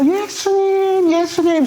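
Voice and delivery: high, drawn-out cry to someone far; calling out. Acted. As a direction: stretch it out high, imitating a blind man's shout. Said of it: "소리치는 거예요". (길게 외쳐 부르는 높은 목소리)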